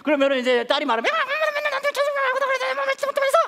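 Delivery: high, loud mock mother yelling; hammed-up shrieking mom; angry mom. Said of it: "둘이 계속 따뜻하게 대화를".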